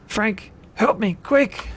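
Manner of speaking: high, loud, slow plea; crying out. Acted. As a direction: call desperately to a friend for help. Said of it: "William Mayfield cries out".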